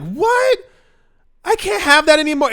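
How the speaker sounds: very high, loud squeal of disbelief; mock outraged teen. Host mimics a sixteen-year-old denied a pacifier, high mock outrage. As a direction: squeal it like a babyish, indignant teenager. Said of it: "having a pacifier when you're sixteen and being like".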